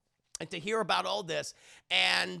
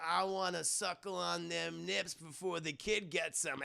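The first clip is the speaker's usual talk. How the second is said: put-on softer, slurred drunk mumble; bad fake drunk; 2 semitones lower, 7 dB quieter than usual. Drunk husband in bed to wife, badly faked slur. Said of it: "he pretends to be drunk".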